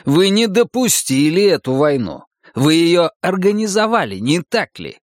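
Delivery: high-pitched, heated charge; accusing. A narrator's reading. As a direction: press the accusation home. Accuses her of starting war.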